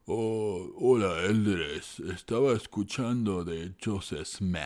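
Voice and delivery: slow, drawn-out Spanish with a put-on American accent; mock gringo accent. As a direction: imitate a friend faking a Utah gringo accent, slowly. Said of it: "y él así hablaba". (imitando a un amigo con acento gringo exagerado y lento)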